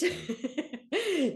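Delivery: high burst of laughter; short amused laugh; bright laugh. Real, not acted. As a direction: laugh it off lightly. Laughing while describing people.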